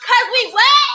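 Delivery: hype. Real, not acted. Hyping the live party.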